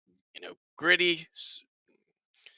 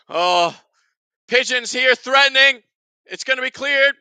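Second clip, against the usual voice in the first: raised, louder live match call; excited play-by-play; 7 semitones higher, 8 dB louder than usual. Real, not acted. Host calling a threatening attack, urgent and raised. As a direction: call the danger as it happens.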